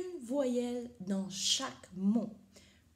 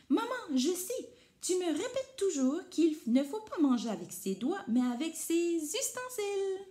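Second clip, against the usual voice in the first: higher, eager little voice; little monkey voice; 8 semitones higher, 5 dB louder than usual. Acted. Teacher voicing Rikili answering his mother, high and eager. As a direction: play a keen young monkey. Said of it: "Rikili dit".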